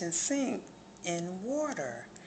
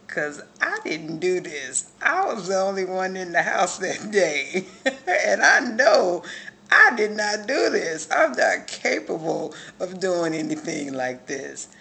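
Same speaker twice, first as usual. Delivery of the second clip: laughing and loud, overwhelmed; real laughing disbelief, louder; laughing loudly; 9 dB louder than usual. Real, not acted. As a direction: burst out laughing as you insist.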